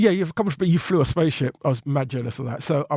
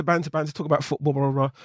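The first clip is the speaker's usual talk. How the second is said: ordinary delivery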